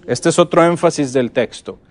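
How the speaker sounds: ordinary talk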